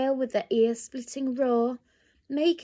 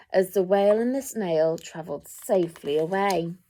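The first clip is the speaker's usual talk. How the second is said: ordinary delivery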